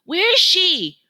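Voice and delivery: high, loud worried call; anxious call. A narrator's reading. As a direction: call out searching the water. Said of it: "Masika asks".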